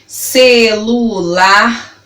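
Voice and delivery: CELULAR very slowly as ce-lu-lar; syllable by syllable. Shown as a demonstration. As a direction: stretch each syllable of CELULAR. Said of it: "falando elas pausadamente pra você".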